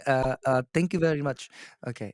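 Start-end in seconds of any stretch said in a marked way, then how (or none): 0.7-1.5 tentative Indian accent. Shown as a demonstration. Coach testing an Indian accent for his client, tentative Indian accent. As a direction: try out a light Indian accent. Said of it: "That's Indian?".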